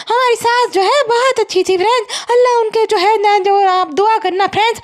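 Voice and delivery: high, mock-mournful vlogger voice; hammed-up imitation of a grieving appeal; चुकैया. Daughter-in-law asking followers to pray, mock mourning. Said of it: "अब ये जो चुकैया है उसकी भी अभी सास गुजर गई ना".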